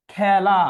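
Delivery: খেলা loud, with wide æ, "khæla"; open pronunciation. Shown as a demonstration. Teacher confirming the answer, খেলা loudly with open æ. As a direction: project খেলা with an open first vowel. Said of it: "বিবৃত উচ্চারণ".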